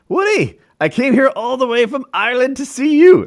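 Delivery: high, loud mock Irish accent. Young Irish stranger greeting Woody at his door, hammed-up Irish accent. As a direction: put on an excited Irish lad's accent. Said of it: "That's my Irish accent".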